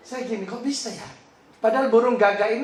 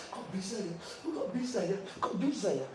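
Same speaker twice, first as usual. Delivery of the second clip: "Kok bisa ya" murmured much softer, in awe; hushed wonder; 12 dB quieter than usual. Retold or imitated. Wife whispering her amazement on the plane, hushed and awed. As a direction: repeat "Kok bisa ya" under the breath, marvelling.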